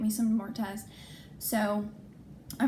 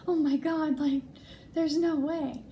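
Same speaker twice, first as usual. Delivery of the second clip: higher, stunned gasp of disbelief; shocked disbelief; 6 semitones higher than usual. Retold or imitated. In the bathroom seeing another positive test, shocked.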